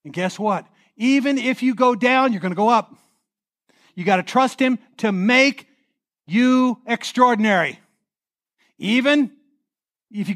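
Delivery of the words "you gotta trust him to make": ordinary talk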